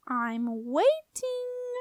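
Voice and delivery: high, stretched-out taunting sing-song; squeaky, mocking "waiting" held long; sing-song sneer. A narrator's reading. Doorkeeper goading the stumped girl, sneering. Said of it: "in a sing-song sneer".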